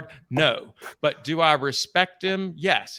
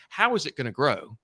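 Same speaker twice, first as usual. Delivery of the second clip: usual speaking voice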